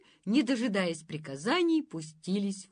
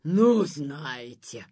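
very slow, louder indignation; outraged; 4 semitones lower, 6 dB louder, much slower than usual. Acted. Monster fully outraged, slow indignant. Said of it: "Окончательно возмутилось чудовище".

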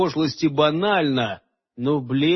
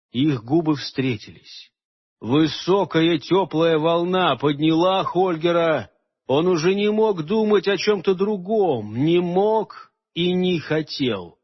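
usual speaking voice

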